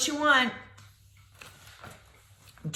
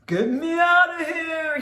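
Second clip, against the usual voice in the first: loud muffled monster cry; monster shout; 3 dB louder than usual. Acted. Monster pleading loudly to be freed from its head. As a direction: shout it from inside the mask. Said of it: "he shouted".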